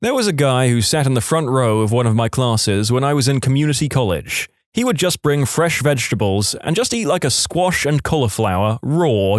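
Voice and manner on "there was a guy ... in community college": usual speaking voice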